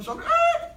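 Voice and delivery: sudden high yelp as a car cuts in; mock startled yelp. Jolted driver yelping at a car. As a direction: yelp like you nearly crashed.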